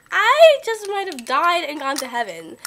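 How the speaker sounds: loud, high, hammed-up swooning; retold ecstatic reply. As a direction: gush dramatically, starting high and loud. Retold ecstatic reply to store lady.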